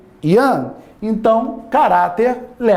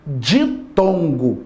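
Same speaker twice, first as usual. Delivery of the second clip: drawn-out key word; 5 semitones lower, much slower than usual. Real, not acted. Teacher lands the key term.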